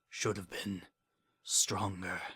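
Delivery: faint dying whisper. Acted. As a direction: breathe the last words out.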